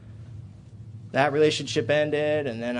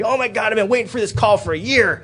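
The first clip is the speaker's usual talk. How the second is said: retold happy outburst, higher and a bit louder; overjoyed; 7 semitones higher, 6 dB louder than usual. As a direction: imitate his thrilled relief at the call. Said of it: "he was so happy".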